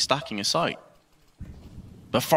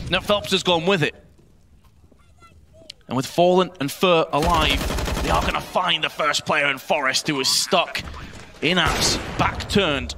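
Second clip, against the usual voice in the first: rising excited play-by-play, higher; esports caster; 8 semitones higher than usual. Real, not acted. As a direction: call every shot as it lands. Live play-by-play of a close round.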